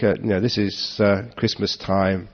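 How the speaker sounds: ordinary talk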